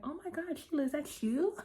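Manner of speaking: a rival cooing a backhanded compliment, mock surprised; fake surprise. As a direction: gush with fake shock at her old friend. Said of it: "Trina come behind her, like".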